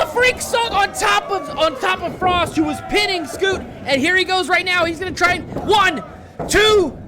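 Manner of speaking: excited live play-by-play call; wrestling commentator. Acted. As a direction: call the match as it happens.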